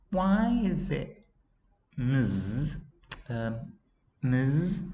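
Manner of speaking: high, slow, hesitant questioning; dreamy pupil. A narrator's reading. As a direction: trail through it dreamily, searching for the word. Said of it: "the class dreamer".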